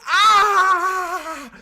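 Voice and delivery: piercing shriek of radio noise; horrible noise shriek. Retold or imitated. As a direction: screech like blaring static.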